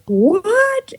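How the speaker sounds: high, stunned squeak of disbelief; a sudden high what?; shocked what. Retold or imitated. Fired employee reacts in shock, high squeak. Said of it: "And I was like".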